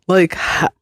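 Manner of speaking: usual speaking voice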